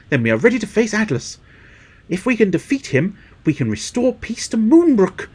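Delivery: high earnest hero voice; rally the team, high and earnest; heroic game character. Acted. Reads heroic character lines.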